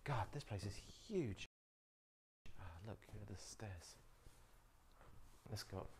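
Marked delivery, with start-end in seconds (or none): none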